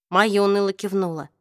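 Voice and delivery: ordinary delivery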